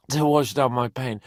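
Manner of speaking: usual speaking voice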